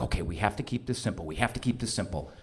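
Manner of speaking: low, quiet and quick, imitating a wife; retold hushed instruction; hushed briefing. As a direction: confide it under your voice, pressed for time. Wife privately briefing him in the car.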